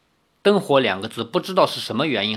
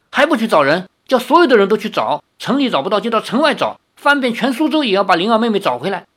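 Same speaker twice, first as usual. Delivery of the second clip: higher, louder and fast orders; commanding; 7 semitones higher, 4 dB louder, faster than usual. A narrator's reading. Yueru orders the maids to search all Suzhou, brisk and bossy.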